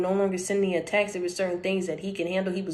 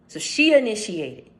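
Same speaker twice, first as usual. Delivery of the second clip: ordinary delivery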